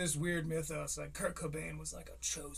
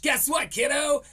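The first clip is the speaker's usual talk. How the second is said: louder, higher, gushing mock hype; hyped-up mock praise; 5 semitones higher, 6 dB louder than usual. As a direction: pump the kid up like a showman. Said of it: "and was like".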